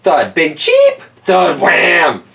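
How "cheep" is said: high chirp; bird cheep. Acted. A bird in the tree chirps, high cheep.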